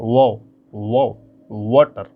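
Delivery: वो stretched very slowly, lips rounded, then वाटर; drawn-out "wo" sound. Shown as a demonstration. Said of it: "इस तरीके से उच्चारण करिए".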